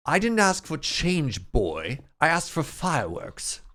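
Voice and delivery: put-on lofty, slow English-accented put-down; [name]. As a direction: flex in a grand mock English accent. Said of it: "do my best English accent".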